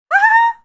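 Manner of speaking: sudden high, loud cackle; manic laugh. Real, not acted. Overtired before an all-nighter, a manic shriek of laughter. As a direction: burst into an unhinged laugh.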